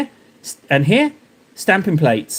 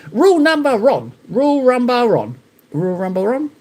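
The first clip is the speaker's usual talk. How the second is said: higher-pitched chanting robot voice; robotic greeting voice; 6 semitones higher than usual. Pretend. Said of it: "Sounds a little bit like, um, junk, the Universal Greetings".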